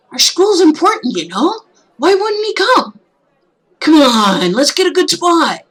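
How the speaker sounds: a keen student pulling you to the front; eager classmate. Acted. Eager friend voiced.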